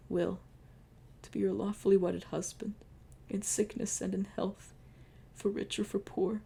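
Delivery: hushed deathbed vows; solemn wedding vows. Acted. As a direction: pledge yourself tenderly. Wedding vows by dying lover.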